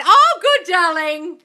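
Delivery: high, loud burst of joy; delighted approval. Real, not acted. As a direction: sing out praise to a client. Cheers a viewer's good news.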